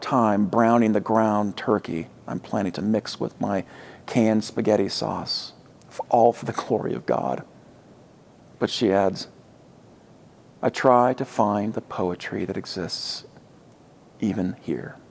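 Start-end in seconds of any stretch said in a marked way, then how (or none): none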